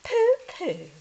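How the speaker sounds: high, loud scoffing; dismissive pooh-pooh. Acted. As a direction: wave it away. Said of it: "Madeline just said".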